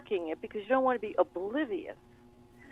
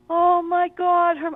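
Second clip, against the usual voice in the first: shocked gasp; 7 semitones higher, 6 dB louder, slower than usual. Retold or imitated. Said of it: "I went".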